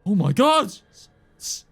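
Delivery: high, loud shocked cry; horrified guard. Acted. Guard seeing the bodies, loud high shock. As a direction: gasp it out at the carnage. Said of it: "sees the carnage and goes".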